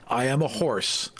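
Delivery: normal talking voice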